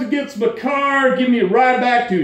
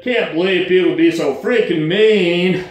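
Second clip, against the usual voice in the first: ordinary talk, nothing marked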